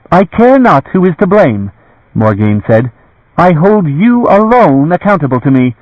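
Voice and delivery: high, cold commanding woman; stern lady. A narrator's reading. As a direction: hold him to account from above. Morgaine's voice, stern.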